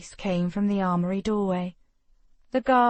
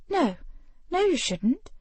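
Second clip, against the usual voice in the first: higher, hurried no's; flustered refusal; 8 semitones higher than usual. Acted. Ravenna refusing Lord Vitor, flustered and high. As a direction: blurt it out, flustered.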